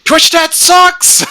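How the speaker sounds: high, drawn-out yelled insult; shout. Acted. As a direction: scream it slowly at the chat. Shouting a joke insult at chat.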